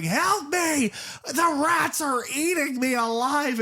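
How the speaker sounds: high, slow screaming plea for help; hammed-up panic, way up high; mock desperate cry. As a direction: shriek like a man eaten alive. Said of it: "the guy's going".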